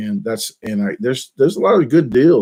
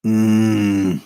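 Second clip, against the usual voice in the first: Sling Blade grunt. Shown as a demonstration. Vlogger warming into the Sling Blade voice, throaty grunt. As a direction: grunt like Karl. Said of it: "That sound like, uh, Sling Blade".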